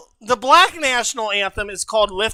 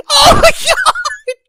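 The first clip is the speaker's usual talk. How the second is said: laughing shout; 4 dB louder, slower than usual. Real, not acted. Laughing at own singing.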